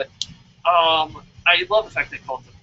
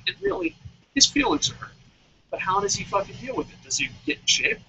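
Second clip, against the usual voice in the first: ordinary delivery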